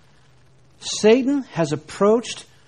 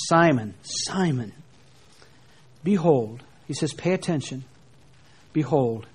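a hint of compassion; 3 semitones lower, slower than usual. Shown as a demonstration. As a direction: speak it like a father warning his child. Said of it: "the compassion that is present in Christ's voice".

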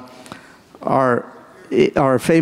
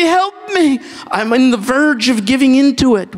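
desperate plea; 9 semitones higher than usual. Retold or imitated. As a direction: beg him high and urgent for help. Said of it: "I say".

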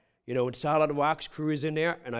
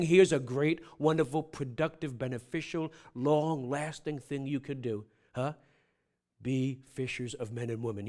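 gentle invitation; 4 semitones lower, 6 dB quieter than usual. Acted. God inviting his people to follow, soft and tender. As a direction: invite them softly, like a father.

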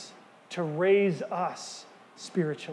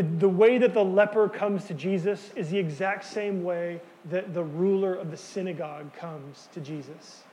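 normal talking voice

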